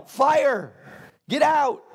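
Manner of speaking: loud, high-pitched alarm shout; yelling a fire warning. Acted. Warning a friend whose kitchen is in flames, urgent yell. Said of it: "You're gonna yell".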